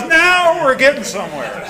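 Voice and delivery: laughing. Retold or imitated. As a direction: burst it out through a laugh. Sponsor's reply, laughing loudly.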